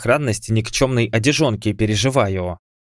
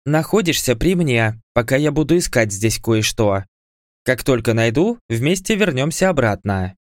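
commanding tone; 3 semitones higher than usual. Acted. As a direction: give the order like a queen. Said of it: "командным тоном молвила".

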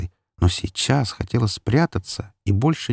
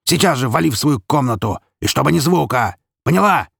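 raised, high-pitched scolding shout; angry order; 6 semitones higher, 3 dB louder than usual. A narrator's reading. Father ordering daughter to her room, angry shout. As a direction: order the child out, peaking on the last word.

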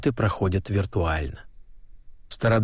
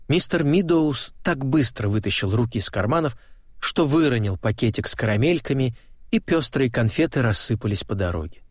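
ordinary delivery

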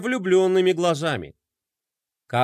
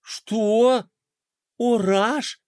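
surprised cry; 4 semitones higher, much slower than usual. A narrator's reading. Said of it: "наперебой закричали".

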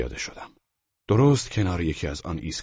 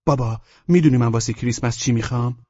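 put-on higher, louder boy's voice; raised, loud son character voice; eager son; 6 semitones higher, 7 dB louder than usual. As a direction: ask Dad like an eager little boy. Son asks father about Christmas present.

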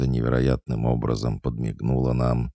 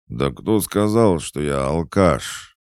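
put-on affronted drunkard; 5 semitones higher, 5 dB louder, slower than usual. Drunk denies being a drunkard.

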